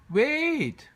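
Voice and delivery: high loud cry to stop them. A narrator's reading. Bear calling after fleeing townsfolk, loud cry. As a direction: call out over the panic. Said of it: "cried George".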